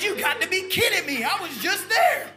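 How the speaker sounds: high, loud, laughing amazement; can't believe it, laughing; laughing disbelief. Retold or imitated. Telling the girl he was just there, laughing in disbelief. Astonished retold reply.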